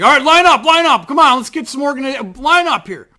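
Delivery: loud, high crowd-herding shout; calling out orders. Retold or imitated. Staffer to waiting fans at the gate, calling out. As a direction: call out briskly over a milling crowd.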